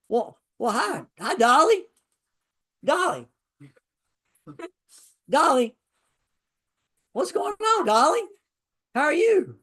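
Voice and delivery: high sweet voice for the dogs; dog greeting voice. Real, not acted. Greeting dogs that just walked in.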